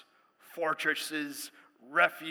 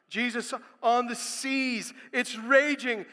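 ordinary talk, nothing marked

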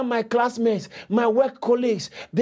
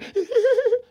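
short giggle; 12 semitones higher than usual. Real, not acted. Preacher amused by talk of crying, giggling. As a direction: break into a quick high laugh.

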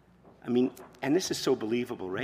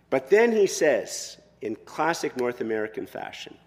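ordinary talk, nothing marked

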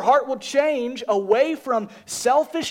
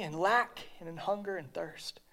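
whisper; 4 semitones lower, 12 dB quieter than usual. Real, not acted. Sermon, soft intimate close.

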